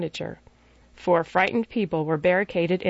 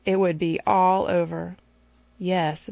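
usual speaking voice

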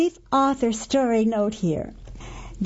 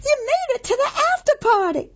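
Gertie voice; 14 semitones higher, faster than usual. A narrator's reading.

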